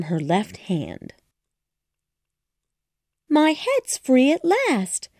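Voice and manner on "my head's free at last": high, slow, delighted relief; Alice thrilled her head is free. A narrator's reading. Alice to herself, high and delighted. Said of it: "said Alice delightfully".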